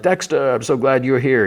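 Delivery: high, glad call of his name; delighted greeting. Retold or imitated. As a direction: welcome him with real joy. Blessing greets Dexter at wake.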